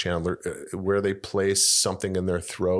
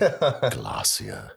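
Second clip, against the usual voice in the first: higher, swooping British narrator word; British narrator accent; 7 semitones higher than usual. Retold or imitated. Repeating a beloved narrator word, high British accent. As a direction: relish the British vowels, starting high. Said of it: "I just love that word".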